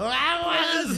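high-pitched imitated shout; retold warning yell. Host retelling how a guy yelled, high mock yell. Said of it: "un güey gritó".

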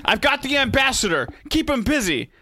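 high-pitched put-on shout at normal volume; acted combat call. Fighter calling to comrades during a shootout, mock high call.

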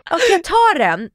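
giddy giving in. Retold or imitated. Shopper caving to the saleswoman, giddy and loud. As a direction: cave in happily, swept along. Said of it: "Jag bara".

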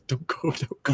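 high, laughing plea not to leave; pleading. Retold or imitated. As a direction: beg him to stay, mock-desperate. Landlady begging him to stay.

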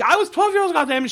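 very high, indignant retold outburst; acted outraged outburst. Nora pushing her abuser, acted outrage. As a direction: protest it high-pitched, imitating her outrage.

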